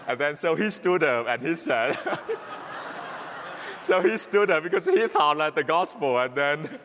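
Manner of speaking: words breaking into laughter; can't hold back the giggles; laughing while talking. Real, not acted. Priest telling the congregation about the prank, laughing through it.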